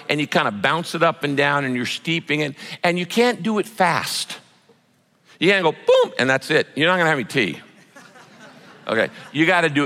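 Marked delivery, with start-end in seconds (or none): 5.7-6.1 punchy, high-pitched "boom" sound effect; sudden boom. Shown as a demonstration. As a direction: pop out a quick boom. Mimes a too-quick tea dunk.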